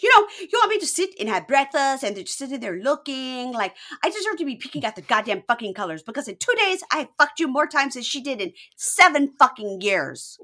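high, loud mock tirade; escalating outrage. Jealous girlfriend ranting to boyfriend, escalating outrage. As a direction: build to a furious punch at the end. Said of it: "she went zero to a thousand".

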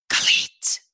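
breathless whispered name; voiceless whisper of a nervous child. Retold or imitated. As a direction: barely get the name out in a whisper. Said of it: "the whisper got worse when I got nervous".

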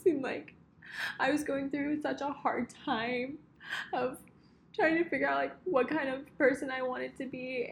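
laughing through tears, voice high; emotional thank-you, close to crying; tearful goodbye. Real, not acted. Tearful thank-you to best friend.

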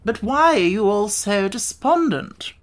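high, slow puzzled insect; Wogglebug inquiring. Acted. Wogglebug asks the glum friends, high slow question. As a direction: ask high and slowly, puzzled. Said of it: "inquired the Wogglebug".

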